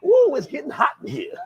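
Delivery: teasing sing-song. Retold or imitated. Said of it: "And I'm like".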